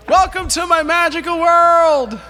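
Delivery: big, high, loud showman welcome; grand announcer welcome. Acted. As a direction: announce it like a ringmaster.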